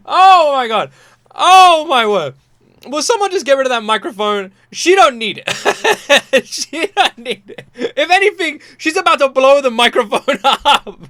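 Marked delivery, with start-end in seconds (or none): 0.1-2.3 much louder, higher amazed cry; overwhelmed. Real, not acted. Reacting to the singer, loud amazed cry. 4.7-11.0 louder laughing disbelief. Real, not acted. Praising the singer, louder laughing talk. Laughing about her power.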